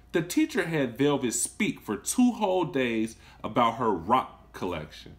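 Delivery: gentle read-aloud storytelling; storybook narrator voice; story narration. A narrator's reading. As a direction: read it to a class of children. Teacher reads story aloud.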